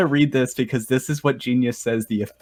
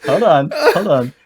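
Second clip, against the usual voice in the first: lightly hammed broken English; 8 dB louder, slower than usual. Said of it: "the official lyrics".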